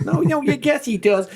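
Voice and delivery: high-pitched excited protest, imitated. Singer insists he knows who she is, excitedly. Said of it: "Mary J Blige was really excited".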